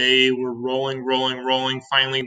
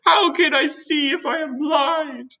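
very high, slow indignant cry; shouting; slower than usual. A narrator's reading. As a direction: wail it out in outrage. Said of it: "shouted the poor earthworm".

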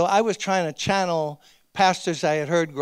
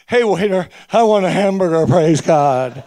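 louder, ordering food in a booming pulpit tone; mock preacher voice; 8 dB louder than usual. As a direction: pretend to preach your lunch order. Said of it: "you don't hear them say".

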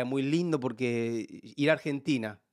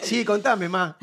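laughing reply; 6 semitones higher, 6 dB louder, slower than usual. Retold or imitated. His own reply to his wife.

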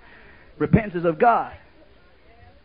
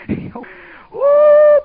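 high, loud preacher's whoop; whooping cry; 11 dB louder than usual. Acted. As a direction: let out a joyful whoop.

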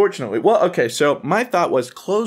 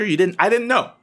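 normal talking voice

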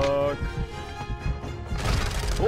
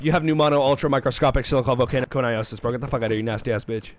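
rapid mocking comeback; fast rattled insult; 5 semitones lower, much faster than usual. Real, not acted. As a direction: rattle it off at top speed. Rapid-fire insult at friend.

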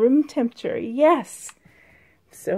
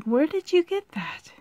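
lightly voiced Dan, higher and puzzled; Dan's question; 6 semitones higher than usual. Retelling a Roseanne scene, Dan asks his wife: higher, puzzled. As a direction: ask it as a bemused husband. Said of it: "And Dan was like".

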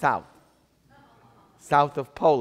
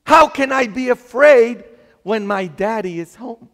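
loud, high rhetorical question; ringing, acted-out confidence; emphatic exclamation; 8 semitones higher, 11 dB louder than usual. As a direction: proclaim it from the pulpit, pitch raised. Exclaims the boy's fearless answer.

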